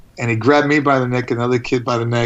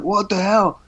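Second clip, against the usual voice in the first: amazed; 8 semitones higher, slower than usual. Retold or imitated. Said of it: "I was like".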